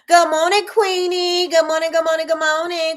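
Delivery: bright sing-song good morning to a favourite viewer; sing-song greeting. Real, not acted. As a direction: greet her like a cheerful host.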